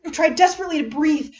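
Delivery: usual speaking voice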